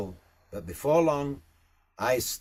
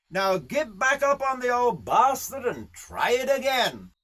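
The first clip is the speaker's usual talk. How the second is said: high-pitched, swearing, scolding horseman; rough bush boss giving a telling-off; exasperated Aussie riding boss; 8 semitones higher than usual. Acted. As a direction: berate the rookie rider, pitch swinging high. Berates a fallen young rider.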